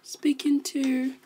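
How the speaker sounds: breathy whispered aside. Acted. Secretary whispers that the Prime Minister talks to herself. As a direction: whisper it so nobody hears.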